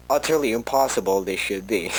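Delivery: usual speaking voice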